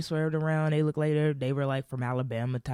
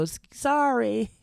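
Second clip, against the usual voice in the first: timid apology; 8 semitones higher, 3 dB louder, slower than usual. Retold or imitated. Family apologizing, voiced.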